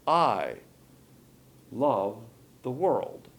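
loud I, then a long pause; I stands alone, emphatic, before a slow "love the world"; stress on I. Shown as a demonstration. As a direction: set I apart, then slowly give the rest. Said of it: "puts all the emphasis on".

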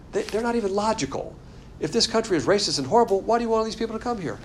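normal talking voice

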